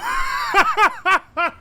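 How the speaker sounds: squealing high laugh; shrieking laugh. Real, not acted.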